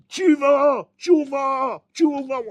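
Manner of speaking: high, loud imitated shout; furious yell. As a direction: yell it like the enraged deaf rav. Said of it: "il se met à hurler". (crier comme le rav furieux, très fort et aigu)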